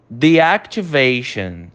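deactivation said very slowly; slow English pronunciation. Shown as a demonstration. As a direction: model deactivation for repetition. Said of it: "você vai repetir a pronúncia". (deactivation bem devagar em inglês)

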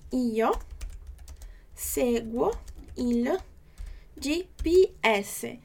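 slow, separated words with long pauses; teacher syllable drill. Shown as a demonstration. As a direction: break it up word by word. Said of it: "você pode acompanhar comigo".

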